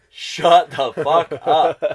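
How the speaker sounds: imitating a relative telling the sisters to stop, mock yelling; mock shout. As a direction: bark it out like a fed-up relative. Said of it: "until someone's like".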